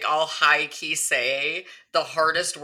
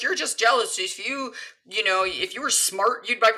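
smug billionaire fan; 6 semitones higher than usual. Retold or imitated. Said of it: "people who stan billionaires that would be like".

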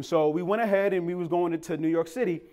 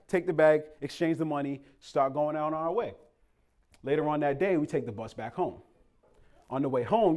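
ordinary talk

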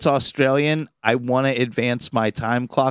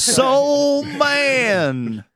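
excited shout; 8 semitones higher than usual. Real, not acted. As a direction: shout out the answer eagerly. Shouts the movie title.

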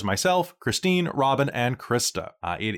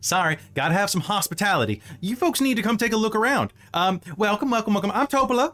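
flustered dock woman; 7 semitones higher than usual. Acted.

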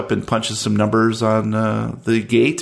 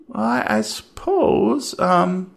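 higher, slow, reluctant; hesitant clerk; 9 semitones higher, slower than usual. Acted. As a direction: drag it out unwillingly.

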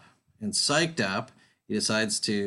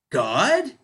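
awed question; 7 semitones higher, 6 dB louder than usual. Acted. Angler asks the voice.

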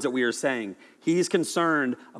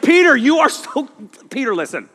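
higher and louder, cut off mid-scold; exasperated Jesus; 10 semitones higher, 7 dB louder than usual. Retold or imitated. Jesus losing patience with Peter, loud and higher. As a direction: blurt the scolding and stop short. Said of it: "He's like".

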